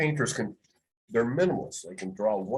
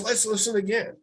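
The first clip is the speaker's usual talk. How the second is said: boss replaying tape; 11 semitones higher than usual. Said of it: "He goes".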